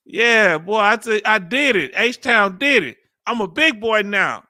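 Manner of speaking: big, loud celebratory boast; triumphant crowing. Real, not acted. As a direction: crow proudly over a small victory. Crowing after managing the word.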